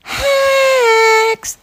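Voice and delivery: high, long-held call. Acted. Hare at the witch's door, calling high and long. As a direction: stretch the one word into a squealed call.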